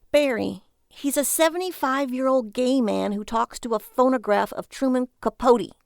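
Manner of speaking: a sarcastic jab at his junk; mocking wife. Lily voice.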